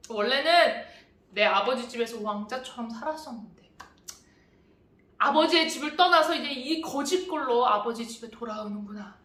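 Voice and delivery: ordinary delivery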